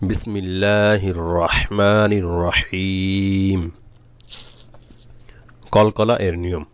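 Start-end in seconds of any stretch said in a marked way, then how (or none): 0.1-3.6 very slow, drawn-out sacred opening; Quranic recitation. Acted. Teacher opens the lesson with bismillah, slow recitation. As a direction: recite it measured, stretching the long vowels.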